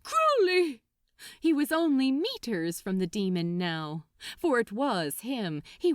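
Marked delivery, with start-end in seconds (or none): 0.0-0.8 loud call, running closer. Acted. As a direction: shout his name, urgent. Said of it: "he called".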